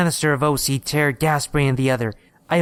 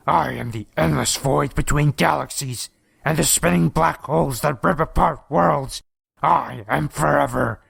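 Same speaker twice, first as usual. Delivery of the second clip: monster proclamation. A narrator's reading. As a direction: declaim like an ancient cosmic evil. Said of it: "he hissed".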